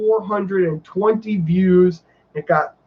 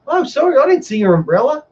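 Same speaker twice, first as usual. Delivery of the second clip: very high Australian lilt; Australian accent; 10 semitones higher, 3 dB louder, faster than usual. Retold or imitated. Attendant apologizing over the missing umbrella, mimicked Australian accent. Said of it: "she was Australian".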